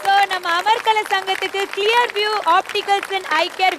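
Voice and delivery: slow, high announcer call; prize announcement. Real, not acted. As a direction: draw out each word, building to the prize. Host announces the sponsored prize.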